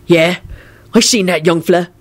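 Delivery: lightly voiced sullen robber; reply with a touch of sulk. A narrator's reading. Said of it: "the man replied sullenly".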